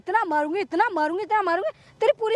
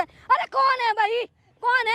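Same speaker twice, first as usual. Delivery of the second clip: higher, sharp shouting at the intruder; angry yelling; 6 semitones higher, slower than usual. Acted. Daughter-in-law yelling at the man who barges in, furious. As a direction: drive the stranger off, furious.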